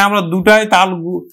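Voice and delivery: ordinary delivery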